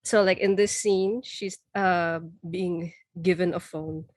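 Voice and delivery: ordinary delivery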